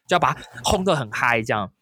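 ordinary talk, nothing marked

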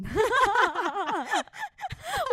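a burst of high, loud laughter; big laughing fit. Real, not acted. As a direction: crack up at the memory. Laughing at her own story.

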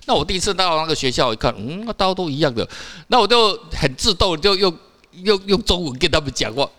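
normal talking voice

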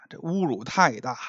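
ordinary delivery, nothing marked

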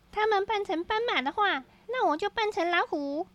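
high eager plotting; character voice. A narrator's reading. Character gets an idea.

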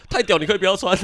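high, loud, laughing sergeant; squad leader. Retold or imitated. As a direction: bark back at the recruit while cracking up. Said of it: "他说". (边笑边高声学班长)

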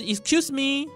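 high, incredulous excuse me; baffled American excuse me; puzzled excuse me. Retold or imitated. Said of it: "来表示说很疑惑". (美式疑惑语气)

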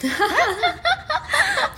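high, loud laugh; a short real laugh; burst of laughter. Real, not acted. Co-host laughing at the sleepy TED talk joke.

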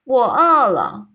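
slow, booming giant's growl; loud hungry giant. A narrator's reading. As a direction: announce your hunger like a huge giant. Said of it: "他的声音大声的说".

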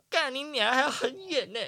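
high, fed-up grumble; exasperated curse. Retold or imitated. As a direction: curse at how far it is. Cursing the long walk. (崩溃地高声抱怨)

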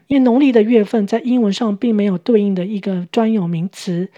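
normal talking voice

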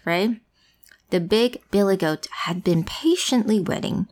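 ordinary delivery, nothing marked

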